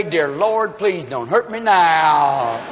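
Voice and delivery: slow, drawn-out pleading; mock prayer. Hungover drinker praying to the Lord, slow mock pleading. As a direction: beg for mercy, stretching the words.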